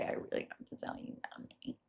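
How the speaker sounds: breathy secret whisper; whispered confession. Retold or imitated.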